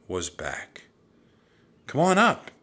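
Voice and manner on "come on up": high, loud beckoning shout. A narrator's reading. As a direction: yell down to someone below. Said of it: "Annie shouted".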